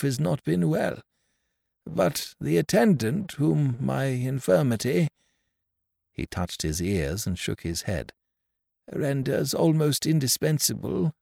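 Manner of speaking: gently voiced deaf old man; old stranger.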